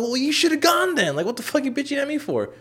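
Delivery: very high-pitched indignant retort; retold angry comeback. Answering a mad brother, retold angry comeback. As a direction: throw it back at him, acting out the row. Retold quarrel, snapping back.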